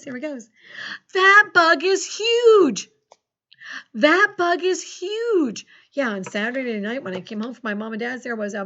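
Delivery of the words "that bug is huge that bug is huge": high, loud, slow That bug is huge; excited exclamation. Shown as a demonstration. Teacher dictating an exclamation, high and loud. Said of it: "Okay, listen close".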